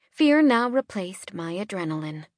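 ordinary delivery

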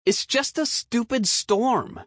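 put-on high dismissive kid voice; scoffing boy. A narrator's reading. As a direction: brush it off with scorn. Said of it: "he scoffed".

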